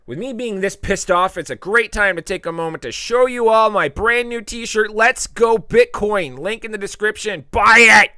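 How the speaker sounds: high, loud infomercial push; loud high ad-read ending in a shout; hammed-up sales pitch. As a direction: sell the shirt like a TV pitchman. Hammy ad pitch for t-shirt.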